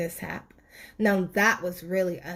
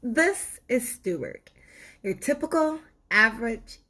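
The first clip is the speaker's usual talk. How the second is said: ordinary delivery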